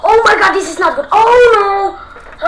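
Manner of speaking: high, loud panicked yelp; frightened cry. Real, not acted. Granny appears suddenly.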